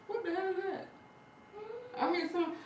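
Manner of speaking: high, sudden startled outburst; a startled, suspicious what-was-that. Real, not acted. Interrupted mid-story by a noise, startled.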